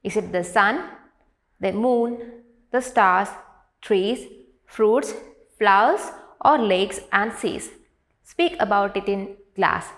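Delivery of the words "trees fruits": ordinary delivery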